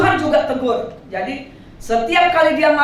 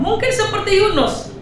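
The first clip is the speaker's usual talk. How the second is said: normal talking voice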